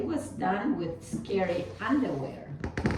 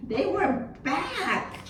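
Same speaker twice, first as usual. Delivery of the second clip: shocked; 8 semitones higher, slower than usual. Acted. Shocked discovery.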